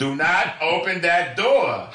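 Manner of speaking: very high, loud, stretched-out words; imitated usher yelling. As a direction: yell each word slowly as the exasperated usher. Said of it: "who is yelling at the kids".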